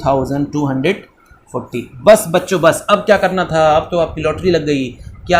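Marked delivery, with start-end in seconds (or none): none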